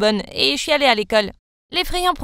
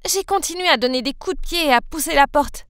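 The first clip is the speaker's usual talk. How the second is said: usual speaking voice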